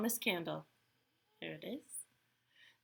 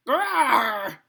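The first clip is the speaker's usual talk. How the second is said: loud, higher growling roar; bear roar; 4 semitones higher, 10 dB louder than usual. A narrator's reading. Old Bear protests as Baba Braina tugs his fur, roaring. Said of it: "Old Bear roared".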